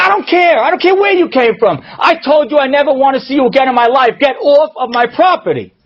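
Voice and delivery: high-pitched, furious outburst; angry shouting. Retold or imitated. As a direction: rant at full force to drive him away. Yitzi rejects Chaim's apology at door.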